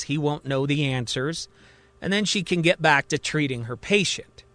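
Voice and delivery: ordinary delivery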